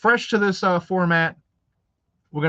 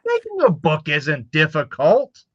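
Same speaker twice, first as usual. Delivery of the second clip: sarcastic; 10 semitones higher, 4 dB louder than usual. Acted. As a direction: pretend it's no big deal, dripping irony. Sarcastic reply about making books.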